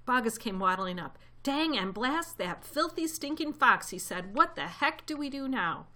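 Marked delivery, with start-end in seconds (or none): 1.4-3.9 high, slow exasperated curse; angry cursing. A narrator's reading. Bogus waddling up to the others, cursing angrily. 4.4-5.9 exasperated. A narrator's reading. Farmer curses in frustration.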